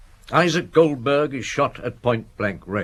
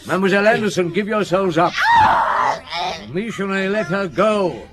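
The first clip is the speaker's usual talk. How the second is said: calling out; 9 semitones higher than usual. Acted. Police calling out at gunfire.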